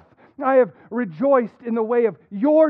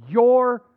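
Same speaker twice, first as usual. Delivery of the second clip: your held and pushed louder; stress on your; 4 dB louder than usual. Shown as a demonstration. Reading the psalm aloud to the church, hitting your. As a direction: leans on the word your. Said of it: "I'm gonna emphasize it as I read".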